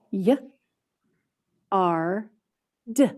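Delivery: Y and R-D said separately with a gap; sounds pulled apart. Shown as a demonstration. Teacher modeling segmenting for young students, split sounds. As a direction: segment yard slowly for the class. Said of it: "Where is the R sound".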